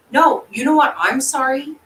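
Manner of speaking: loud, high, cutting in on granny; indignant retort. Retold or imitated. Granddaughter answering granny's apology about mom, indignant retort. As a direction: push back hard, with a sarcastic apology.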